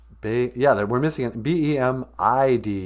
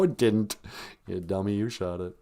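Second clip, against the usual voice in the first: normal talking voice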